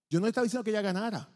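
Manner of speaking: ordinary talk, nothing marked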